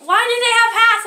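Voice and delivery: usual speaking voice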